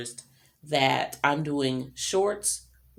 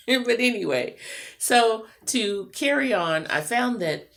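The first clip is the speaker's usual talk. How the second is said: laughing higher and louder; 8 semitones higher, 6 dB louder than usual. Real, not acted. Amused by her own clip, talking through a laugh. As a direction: still laughing as she picks the thread up.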